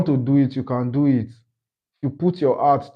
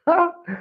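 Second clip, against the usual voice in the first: short, high chuckle; leftover laughter. Real, not acted. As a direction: let out one last high giggle. Still laughing at Edge.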